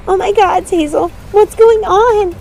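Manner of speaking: high, loud panicked cry; alarmed shriek; frightened cry. Acted. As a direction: cry out in terror at the skeletons. Sisters facing skeleton legion.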